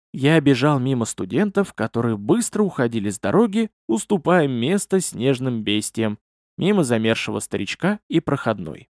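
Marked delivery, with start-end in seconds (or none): none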